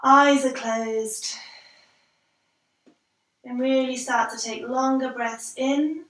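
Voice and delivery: unhurried relaxation cueing; guided breathing. Real, not acted. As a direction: lead them calmly through each breath, leaving space. Leads a guided breathing exercise.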